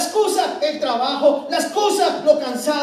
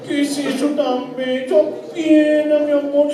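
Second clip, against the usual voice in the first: put-on baby talk to a son; slower than usual. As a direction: coo tenderly to the little boy. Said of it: "cómo le hablas". (padre hablándole a su bebé con voz tierna y cantada)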